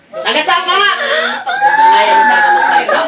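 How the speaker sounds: high, loud exorcist's challenge; forceful demand. Real, not acted. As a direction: shout for an answer, pitch way up. Demands answer from silent spirit.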